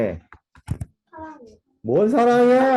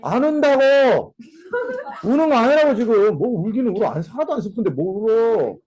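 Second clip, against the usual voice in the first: protesting denial; 4 semitones higher, 3 dB louder than usual. Acted. Jilted lover denying tears, hammed-up loud protest. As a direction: hotly insist you are not crying, hammed-up. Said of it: "화자가 이렇게 대답을 한다는 거야".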